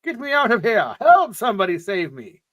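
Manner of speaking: high, loud panicked cries for help; yelling for help. Acted. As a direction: shout it out trapped in the elevator.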